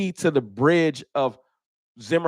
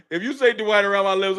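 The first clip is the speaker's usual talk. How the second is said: laughing; 6 semitones higher than usual. Real, not acted. Laughing threat to a chatter.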